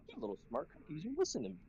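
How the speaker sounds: high, cutesy retold aside; playful mock praise. Instructor to trainees correcting mistakes, playfully high-pitched. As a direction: pitch it up as if cooing at clever trainees.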